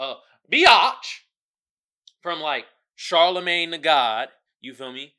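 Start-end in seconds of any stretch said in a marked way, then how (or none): 0.0-1.2 blurted out loud; mocking shout of the insult; mock yell. Mocking the name people called.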